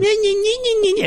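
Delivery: high, nasal-free mock baby whine; mock him like a whining baby, way up high; talking to a grumbling broker, mock baby whine; whiny crying baby. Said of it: "you're like a whiny, crying baby".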